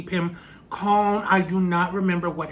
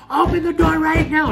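much higher and louder, barking the order; shouting demand; 10 semitones higher, 7 dB louder than usual. Retold or imitated. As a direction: yell it through a locked door. Sister shouting through the door.

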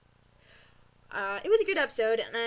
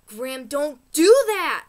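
slow, louder scold; 3 semitones higher, 12 dB louder than usual. Real, not acted. Scolding the Grimm writers, slow and loud. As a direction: tell off the show's writers.

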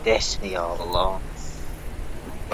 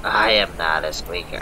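lowered voice; 6 semitones lower than usual. Pretend. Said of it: "I lower my voice like this".

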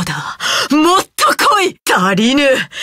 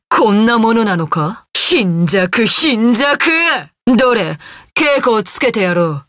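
usual speaking voice

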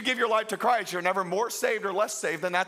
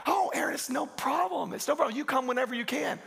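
higher, breezy easygoing pastor; cheery pastor; 6 semitones higher than usual. Retold or imitated. Host pastor reassuring the late preacher on the phone, higher and breezy. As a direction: brush it off kindly, no problem at all. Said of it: "I call him".

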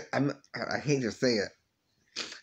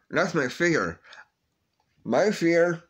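voice breaking; 5 semitones higher, 4 dB louder, slower than usual. Real, not acted. Tearful confession of fear.

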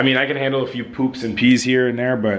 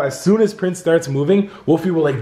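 ordinary talk

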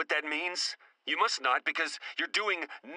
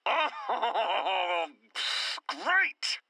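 high, bitter mock cheer; sarcastic laugh; 6 semitones higher than usual. Agent denied access again, sarcastic laughing great.

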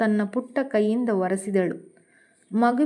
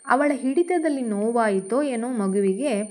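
ordinary delivery

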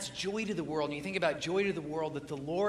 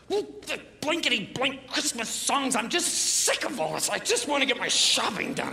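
grumpy shopper grumbling; 5 semitones higher than usual. Retold or imitated. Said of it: "And he goes".

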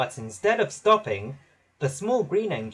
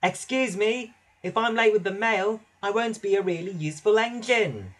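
polite little engine; 6 semitones higher than usual. A narrator's reading. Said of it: "so Percy tried again".

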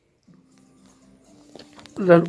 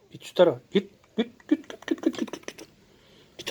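git git fading from soft to a whisper; fading shoo; 8 dB quieter than usual. Real, not acted. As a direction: shoo the cat away, trailing off into a whisper. Shooing cat, voice fading away.

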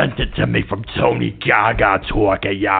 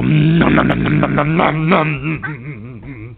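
mock nom noises; 5 semitones higher, slower than usual. Caller making eating noises.

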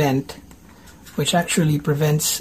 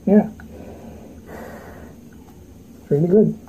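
muffled behind respirator; speaking inside half mask; through a face mask; 4 semitones higher than usual. Real, not acted. Seen 2: Respirator mask covers nose and mouth throughout the labelled words.

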